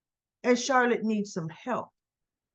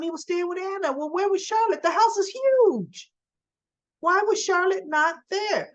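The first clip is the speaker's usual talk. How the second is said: indignant; 7 semitones higher than usual. Real, not acted. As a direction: demand answers, outraged. Indignant rant about neglect.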